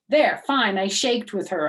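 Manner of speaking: loud, high, irritated; grudging huff. Acted. Junie fake-shakes with May, loud and grudging. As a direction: toss it off crossly.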